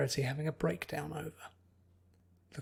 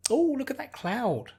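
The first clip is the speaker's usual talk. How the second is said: distracted girl spotting a cloud, thrilled; bubbly Pinkie; 9 semitones higher, 5 dB louder than usual. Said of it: "Pinkie cried".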